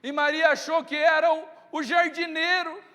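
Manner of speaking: ordinary talk, nothing marked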